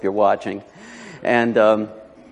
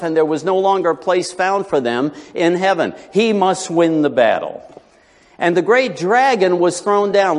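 raised, declamatory Bible reading; higher-pitched, ringing pulpit reading; preacher reading Scripture; 6 semitones higher than usual. Real, not acted. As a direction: proclaim the verses to the congregation. Reads Scripture to congregation.

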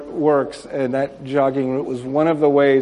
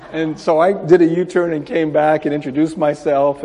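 ordinary talk, nothing marked

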